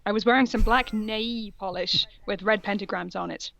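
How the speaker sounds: ordinary talk, nothing marked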